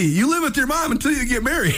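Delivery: high, mock New York Italian uncle; a hint of an Italian New York uncle, incredulous; Italian-American accent. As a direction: ham up a lightly voiced Italian-American uncle. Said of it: "these hardcore Italian accents".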